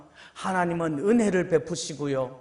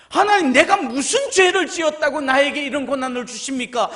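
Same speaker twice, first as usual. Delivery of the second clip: loud, indignant protest; Job arguing; 6 semitones higher, 7 dB louder than usual. Acted. Job arguing with God, loud and indignant. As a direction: argue hard with God. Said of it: "이러고 욥이 따지고 있는데".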